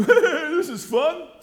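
loud, high, laughing voice; gleeful like a child with a toy; laughing mock delight. Pastor voicing a madman enjoying shooting, laughing mock glee.

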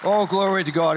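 loud, high praise to God; preacher leading praise, loud and high; praise shout. Real, not acted. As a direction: lift it up in praise.

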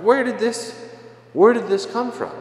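high, stunned cry of disbelief; mock shock. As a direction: throw it up high like you've been blindsided. Imitating shock at life's curveball.

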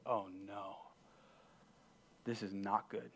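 soft, low voice of dawning dread; hushed dismay. Acted. Caught rebel facing his whole life, hushed dismay. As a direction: say it quietly, as if sinking inside.

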